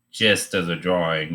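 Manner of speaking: ordinary talk, nothing marked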